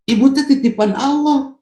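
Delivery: husband praising his wife, voice pitched high; husband doting on wife. Acted. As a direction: pitch way up and fuss over her like a husband.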